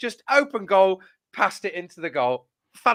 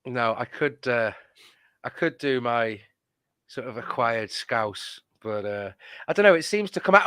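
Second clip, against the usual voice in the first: ordinary talk, nothing marked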